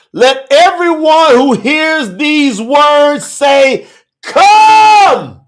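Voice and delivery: slow, high calls with shouted peaks; fervent preaching. Real, not acted. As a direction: preach it full out, stretching the key words. Preaches at full force.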